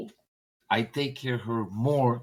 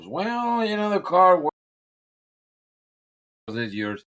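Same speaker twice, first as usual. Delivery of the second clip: put-on hesitant old timer; 6 semitones higher, 3 dB louder, slower than usual. Old timer hedging price.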